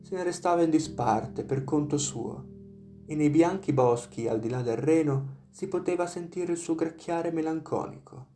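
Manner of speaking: plain reading voice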